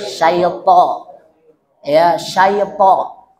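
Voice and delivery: syaitho with a full rounded o; correct pronunciation. Shown as a demonstration. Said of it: "bukan minasy syaitha".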